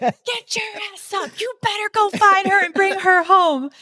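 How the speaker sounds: high, loud mock wife shouting; wife yelling. Wife ordering husband out to search, loud high mock yell. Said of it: "And she was like".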